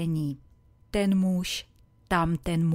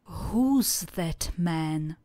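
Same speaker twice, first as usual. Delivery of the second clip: slow model question; much slower than usual. Shown as a demonstration. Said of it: "A vy se zeptejte".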